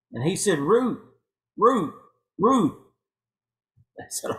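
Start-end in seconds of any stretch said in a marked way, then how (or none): 0.6-2.8 higher, louder word used as a bark; a light dog bark in separate bursts. Acted. Dog names the greatest baseball player, light barking. Joke dog answers in barks.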